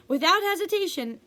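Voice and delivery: plain reading voice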